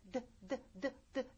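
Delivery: 드 as small, soft voiced taps. Shown as a demonstration. Teacher setting up voiced against unvoiced, 드 short and soft with voice. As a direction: repeat 드 briefly, vocal cords on. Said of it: "드 하다 울리던 입모양".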